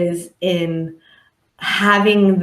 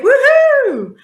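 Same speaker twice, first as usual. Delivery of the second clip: high, loud whoop of joy; joyful woohoo; 6 dB louder than usual. Retold or imitated. Therapist mimics happy person cheering, loud whoop. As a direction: cheer out like you're thrilled. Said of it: "you're like".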